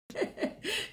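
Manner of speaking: short high burst of laughter; sudden laugh. Real, not acted. Pastor laughing at a technical hitch, sudden laugh. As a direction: laugh it off, caught by surprise.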